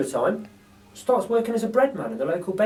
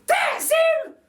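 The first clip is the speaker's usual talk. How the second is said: very high, slow, chirpy lady voice; a cheeky cockney burglar lady; Burglar Betty; 7 dB louder than usual. A narrator's reading. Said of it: "says Burglar Betty".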